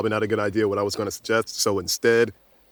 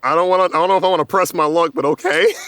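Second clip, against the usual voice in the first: nervous excitement; 7 semitones higher, 8 dB louder, faster than usual. Real, not acted. Nervous before rolling dice.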